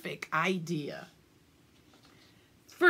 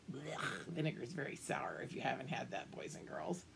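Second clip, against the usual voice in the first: a low, much softer confiding aside; 7 semitones lower, 13 dB quieter than usual. Real, not acted. Reader explains sour vinegar to the boys and girls, soft and low.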